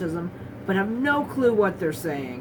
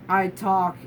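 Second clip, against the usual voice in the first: normal talking voice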